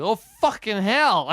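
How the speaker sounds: high, loud, drawn-out yell; mock alarmed shout. Host joking about how they would dodge an attack, mock panicked shout. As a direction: play panicked for laughs.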